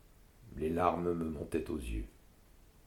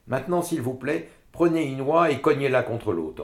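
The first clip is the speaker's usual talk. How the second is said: commanding mother, higher and louder; mother ordering; 8 semitones higher, 7 dB louder than usual. A narrator's reading. Mother orders the women to knock the geese. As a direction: snap the order at the room. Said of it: "ordonna ma mère".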